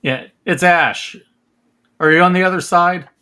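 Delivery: loud call through a door. Pretend. Calling through door to Jade.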